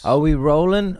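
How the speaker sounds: loud, high call to the crew. Real, not acted. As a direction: call it out brightly across to the producer. Calls out again after the answer.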